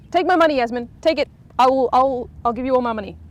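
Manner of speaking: loud, high, gushing offer of money; eager fan. Acted. Fan begging Yasmin to take the money, loud and gushing. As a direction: push the cash on her, overexcited.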